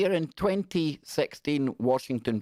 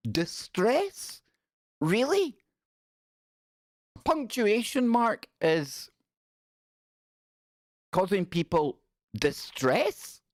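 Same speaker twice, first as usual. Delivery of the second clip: much higher, slow incredulous drawl; mock disbelief; 9 semitones higher, slower than usual. Reacting to an article on full stops, high mock disbelief. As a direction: act stunned that anyone could be hurt.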